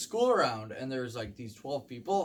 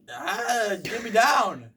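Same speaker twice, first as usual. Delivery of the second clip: loud cry for help; 7 semitones higher, 9 dB louder, much slower than usual. Retold or imitated. Stranded man begging rescuers.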